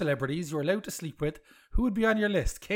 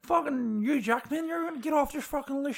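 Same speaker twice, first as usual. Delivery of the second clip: mock Jamie Dornan accent; 7 semitones higher than usual. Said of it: "In that accent?".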